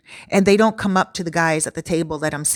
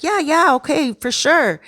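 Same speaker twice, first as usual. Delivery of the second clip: high, eager imitation of a guy agreeing; excited; 8 semitones higher than usual. Retelling the guy's excited yes to the flirting woman, high and eager. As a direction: bubble over with eagerness. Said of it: "he's all excited".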